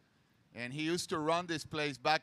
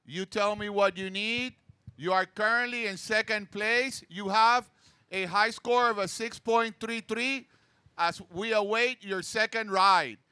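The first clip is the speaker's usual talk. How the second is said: upbeat PA call to surfers, higher and louder; announcer voice; 4 semitones higher, 5 dB louder than usual. Real, not acted. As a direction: call out the standings over the beach. Announcing heat standings on mic.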